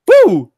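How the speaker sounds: very high, loud woo of pain; loud high-pitched yelp; character yelp. Acted. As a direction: let out a shrill yelp.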